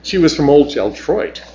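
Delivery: ordinary delivery, nothing marked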